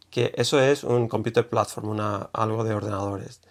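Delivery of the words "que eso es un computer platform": ordinary talk, nothing marked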